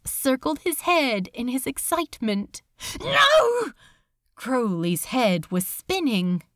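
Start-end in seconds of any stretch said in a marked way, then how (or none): 3.0-3.7 high, loud desperate no; desperate terrified shout; terrified cry. A narrator's reading. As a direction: cry out in panic. Crowley resisting in terror.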